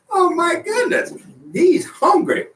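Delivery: squeaky high voice, breaking with laughter; high laughing exclaim. Real, not acted. Host squeals about his hungry cohost, laughing high.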